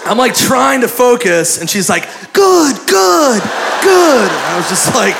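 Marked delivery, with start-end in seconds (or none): 2.3-4.3 very high, drawn-out cheers; imitating an eager masseuse urging someone on; high-pitched mock encouragement; cheering on. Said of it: "she starts cheering me on".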